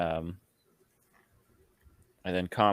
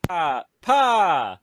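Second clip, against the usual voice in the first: excited greeting; 9 semitones higher, 4 dB louder, slower than usual. Pretend. Greets his demon dad eagerly.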